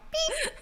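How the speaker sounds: high, soft imitated beep. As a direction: chirp like a smoke alarm.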